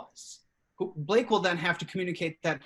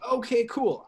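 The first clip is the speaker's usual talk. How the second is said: ordinary delivery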